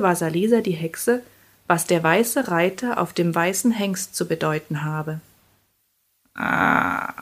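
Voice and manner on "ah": long, very low murmured "ah"; murmuring. A narrator's reading. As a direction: murmur it deep in the throat. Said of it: "murmelte die Jaga".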